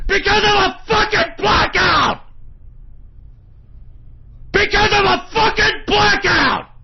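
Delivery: high, loud enraged yell; real furious shout. Real, not acted. Broadcaster raging to listeners over a blackout, furious shout. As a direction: bellow it in a real rage. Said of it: "I'm so fucking pissed".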